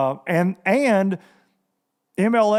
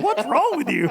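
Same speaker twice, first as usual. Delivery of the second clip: laughing question; 8 semitones higher than usual. Retold or imitated. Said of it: "And he was like".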